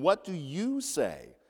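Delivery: slow question with "you" emphatic and stretched; stress on YOU. Shown as a demonstration. Preacher repeats the important question, stress on "you". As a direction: make "you" stand out as if bolded and underlined. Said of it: "should be capitalized and bolded and underlined".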